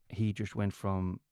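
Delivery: ordinary talk, nothing marked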